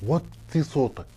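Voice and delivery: low, soft, slow shushing; hushing. Acted. Hushes a loud guest.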